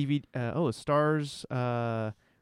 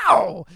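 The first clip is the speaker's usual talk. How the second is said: cat yowl; 8 semitones higher, 13 dB louder than usual. Pretend. Cat impression after request.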